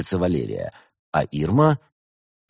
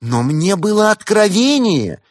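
high, loud, drawn-out protest; affronted, high-pitched cry; indignant outburst; 12 semitones higher, 7 dB louder, slower than usual. A narrator's reading. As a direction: protest in slow outraged wail. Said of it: "и возмутилась".

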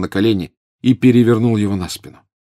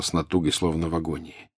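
ordinary delivery, nothing marked

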